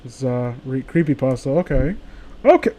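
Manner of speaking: usual speaking voice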